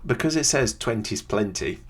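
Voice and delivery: normal talking voice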